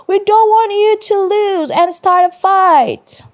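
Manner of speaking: very high, loud playground yell; called out. A narrator's reading. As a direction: shout it across the schoolyard as a kid. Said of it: "someone called".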